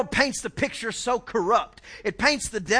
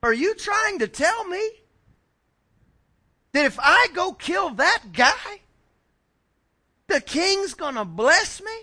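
incredulous David; 8 semitones higher, 5 dB louder than usual. Acted. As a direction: act out astonishment, rising higher on the questions. Acting out David's astonished reaction.

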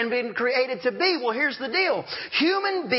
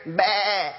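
short imitated goat bleat; 3 semitones lower than usual. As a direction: bleat like a goat hiding in the house. Goat bleating in the living room.

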